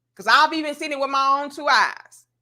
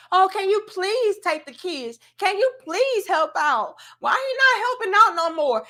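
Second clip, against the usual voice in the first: mock begging mother; 6 semitones higher than usual. Mocking mother begging for help.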